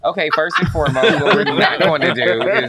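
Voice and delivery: real laugh while protesting. Real, not acted. Laughing at being called Betty Crocker.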